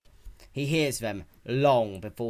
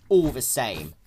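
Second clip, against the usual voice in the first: firm, louder grim statement; grim declaration; 3 semitones higher, 10 dB louder than usual. A narrator's reading. Narrator voicing the dragon's contempt for hunters, firm and loud. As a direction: state it like a verdict on the intruders.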